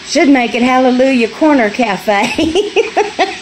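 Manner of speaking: ordinary talk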